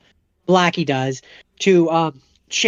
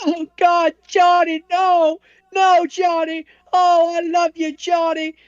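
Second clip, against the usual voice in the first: high mock-tragic wail of a woman's voice; over-the-top falsetto lament; hammed-up distraught heroine; 12 semitones higher, slower than usual. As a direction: overplay the heartbroken girlfriend.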